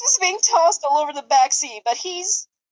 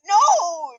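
hissed no; 6 semitones higher, 5 dB louder than usual. Acted. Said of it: "Trix hissed".

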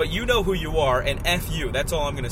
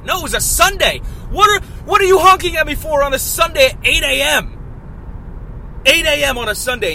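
much higher, much louder indignant shout; outraged shout; 11 semitones higher, 9 dB louder than usual. Real, not acted. Vlogger raging about honking on a Sunday morning, outraged shout. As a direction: blast the early Sunday honker.

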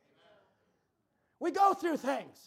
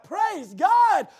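excited praise; 2 semitones higher, 10 dB louder, slower than usual. Retold or imitated. Preacher recalling his joy at the message, loud. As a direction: shout your delight. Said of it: "And I thought".

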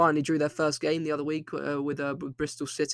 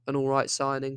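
ordinary talk

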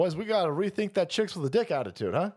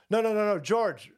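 ordinary talk

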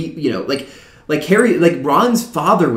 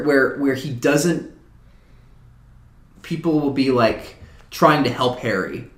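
ordinary delivery

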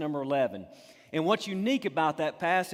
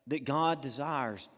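ordinary talk, nothing marked

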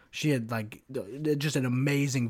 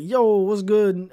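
loud, high, drawn-out call; retold hearty greeting; 7 semitones higher, 9 dB louder, slower than usual. Greeting a new squadmate on mic, hearty drawn-out shout. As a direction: call it out big and slow.

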